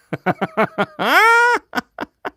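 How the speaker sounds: high-pitched staccato belly laugh; real high cackling laugh; burst of laughter. Real, not acted. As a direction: laugh hard at a silly pun, rising high. Laughs at a viewer's joke.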